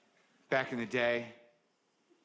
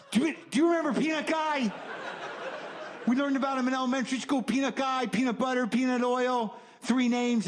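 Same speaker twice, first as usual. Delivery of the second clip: frantic voicemail; 8 semitones higher than usual. Retold or imitated.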